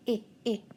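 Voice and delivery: "I" as two short "ih" vowels; sounding out. Shown as a demonstration. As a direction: repeat the short i sound, not the letter name. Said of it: "So let's sound it out".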